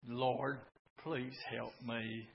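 tiny soft plea; a whisper-soft plea to the Lord; meek prayer. Retold or imitated. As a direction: barely voice a small prayer. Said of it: "does not mean he said".

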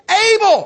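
able cried out high and loud; stress on able. Shown as a demonstration. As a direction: hit the word able hard and hold it. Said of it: "underline that word".

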